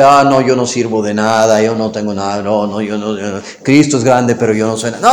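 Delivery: mock the sheep who puts itself down; a hammed-up pious "I'm nothing" murmur; false humility. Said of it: "una falsa humildad".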